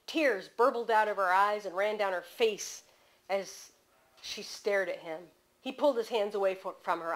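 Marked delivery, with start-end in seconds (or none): none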